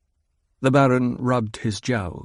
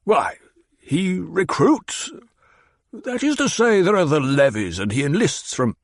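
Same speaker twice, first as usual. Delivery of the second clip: higher, pouting whine; petulant; 8 semitones higher than usual. Acted. Baron answers Hawat like a pouting baby, higher. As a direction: sulk like a spoiled child. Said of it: "his voice carried a tone of petulance".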